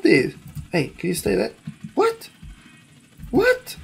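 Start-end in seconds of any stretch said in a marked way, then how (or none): none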